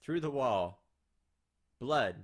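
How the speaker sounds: punchy loud narration; dramatic loud reading. Acted. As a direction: hit each word hard.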